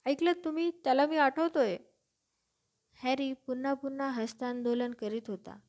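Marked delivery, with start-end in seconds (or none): 0.1-1.8 raised, high-pitched delight; thrilled exclamation, higher voice; excited cry. A narrator's reading. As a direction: announce it proudly to everyone around. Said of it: "डिडलस डिगल ओरडलाच".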